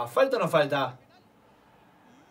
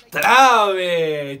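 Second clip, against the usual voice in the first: shouting; 9 dB louder than usual. Real, not acted. Calling out to player.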